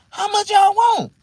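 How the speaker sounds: loud, high, drawn-out question; slow, high-pitched excited ask; eager haggling. Retold or imitated. Acts out his eager question.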